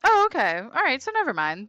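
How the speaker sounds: surprised. Real, not acted. Relieved reaction to answer.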